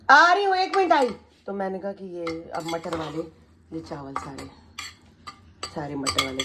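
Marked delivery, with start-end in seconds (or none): 0.1-1.1 high, loud call to someone in another room; loud call to someone away from the mic. Real, not acted. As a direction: call out across the house.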